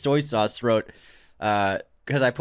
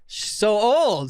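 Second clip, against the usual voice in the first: mock shocked; 7 semitones higher, much slower than usual. Mock scandalized teasing.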